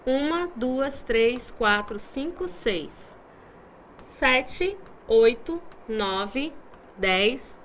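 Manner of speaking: put-on slow sing-song counting, each number set apart for the kids to join; counting with children. Shown as a demonstration. Teacher counting together with the class, slow and inviting. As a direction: count the numbers out one by one for little ones. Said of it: "vamos contar juntos?".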